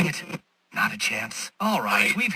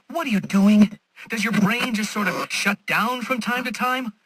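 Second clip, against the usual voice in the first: exasperated villain; 6 semitones higher than usual. Acted. Villain baffled that Batman lingers, exasperated. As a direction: demand an answer, insulting his wits.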